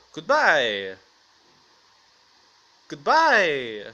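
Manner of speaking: loud goodbye with a swooping, drawn-out fall; mock intimidating. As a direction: boom it out as a hammed-up street threat. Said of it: "This is the most intimidating voice".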